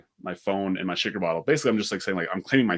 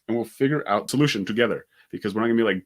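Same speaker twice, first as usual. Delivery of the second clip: usual speaking voice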